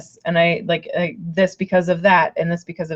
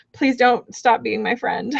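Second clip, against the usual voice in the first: usual speaking voice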